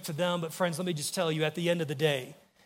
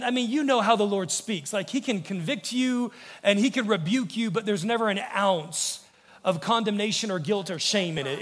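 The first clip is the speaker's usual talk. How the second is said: ordinary delivery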